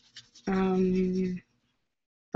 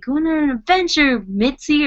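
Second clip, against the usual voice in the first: lightly imitating an excited man, a bit higher; chirpy invite to the dog, a little raised; excited guy quote; 7 semitones higher, 5 dB louder than usual. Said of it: "and he's like".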